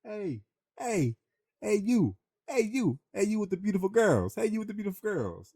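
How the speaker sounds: high calling-out hey you from afar; hollering carnie. Retold or imitated. Carnie calling to the narrator from fifty yards, high hollering call. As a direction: hail a passer-by across the midway. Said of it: "He just starts hollering".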